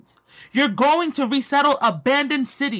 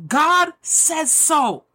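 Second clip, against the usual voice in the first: excited; 6 semitones higher than usual. Real, not acted. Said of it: "I'm getting so excited reading this".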